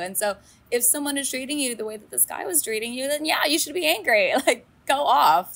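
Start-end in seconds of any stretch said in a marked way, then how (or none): 3.1-5.5 high, loud go-off cheer; hyped up. Real, not acted. Host rallying listeners to be angry, high and loud. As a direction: laugh into a rallying cry.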